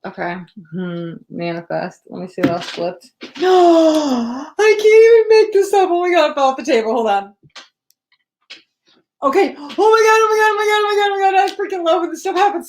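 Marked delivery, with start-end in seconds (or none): 3.2-12.7 high, loud, gasping burst of excitement; shrieking with real delight at a card; excited gasp. Real, not acted. Reader thrilled as a tarot card falls, shrieking with joy. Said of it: "Sorry, I get so excited".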